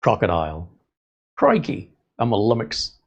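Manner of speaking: lightly put-on Australian accent; Steve Irwin. Imitating a croc hunter for a listener, light mock Australian accent. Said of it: "He sounds like".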